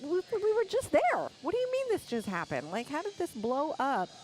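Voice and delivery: mock disbelief. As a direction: sound floored and baffled. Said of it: "we're like".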